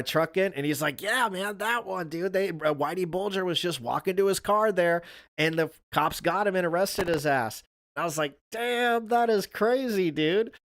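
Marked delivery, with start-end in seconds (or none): 1.0-7.6 higher, eager retelling voice; lightly voiced coworker spilling the news; hyped coworker imitation. 8.5-10.5 much higher, slow amazed drawl; retelling his amazed reply to the coworker; mock amazement. Retold amazed reaction.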